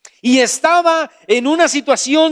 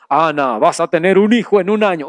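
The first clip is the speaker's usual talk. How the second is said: much lower, fast divine voice; voice from heaven; 5 semitones lower than usual. Acted. Preacher voicing a heavenly voice to Ana, much lower. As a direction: boom it low like a voice from above. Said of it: "Una voz se oyó y dijo".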